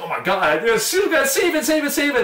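high-pitched mock frantic plea; urgent high-voiced retold begging; flipping out. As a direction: imitate an excited scientist, pitch way up. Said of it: "the guy flipped out".

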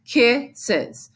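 "kisses" split with a gap between syllables; deliberate syllable-by-syllable "kisses"; two separate parts. Shown as a demonstration. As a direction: sound out "kisses" as two beats. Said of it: "There's two parts to that word".

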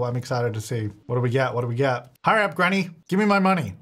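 usual speaking voice

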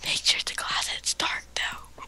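creepy whisper; hushed and sinister. Pretend.

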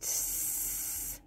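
a long breathy snake hiss through the teeth. A narrator's reading. Raccoon hisses at the dog, a sharp hiss. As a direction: let the raccoon hiss like a warning. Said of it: "Raccoon hissed".

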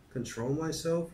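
put-on high, indignant child's retort; mild mock yell. A narrator's reading. Fern answers her father back, a mild high yell. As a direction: pitch it up in understated outrage. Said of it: "yelled Fern".

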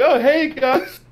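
high-pitched mock hello; cheery mimicked greeting. Oblivious friend riding up to his group, mimicked high greeting.

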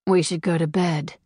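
plain reading voice

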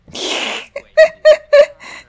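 high, loud staccato laugh; loud squealing laughter. Real, not acted. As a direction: burst into high-pitched giggles. Laughs at the game.

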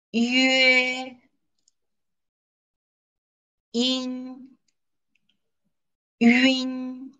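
teacher drilling syllables for students to repeat; repeat-after-me drill. Shown as a demonstration. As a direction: pause after each for the class to echo. Leads a choral drill.

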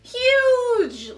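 huge shouted high and loud; excited high-pitched huge. Real, not acted.

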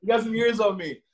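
real laughter, high and bright through the words; laughing. Real, not acted. Guest laughing at the host's joke about being old, laughing through words. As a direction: laugh it off while answering.

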